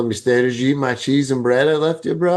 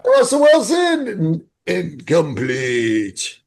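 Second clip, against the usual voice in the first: high, slow play-by-play call; mock announcer; 4 semitones higher, much slower than usual. Mocking the useless mouth guard like a sportscaster.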